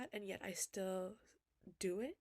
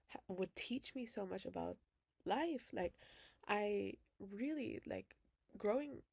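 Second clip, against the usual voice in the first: ordinary delivery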